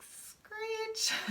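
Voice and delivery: high-pitched brake screech; screech imitation. Explaining the emergency brake to listeners, a high vocal screech.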